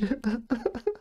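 high-pitched giggle; real corpsing laugh; helpless laughter. Real, not acted. Cracks up while reading.

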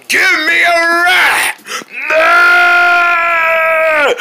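slow, whiny shout of a demand; two kids yelling over a ride, whiny shouting; tearful shouting. Acted.

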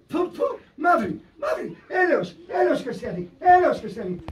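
retold cries starting high and settling; imitated deathbed cries. Dying person calling out for days, imitated cries for mercy. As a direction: mimic repeated cries for mercy, moderately voiced. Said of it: "Και φώναζε".

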